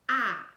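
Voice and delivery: "ah" said loud as the short O; short vowel sound. Shown as a demonstration. Teacher showing a class the short vowel in not, loud demonstrated "ah". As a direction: sound out a clear, punched "ah". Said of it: "say its short vowel sound".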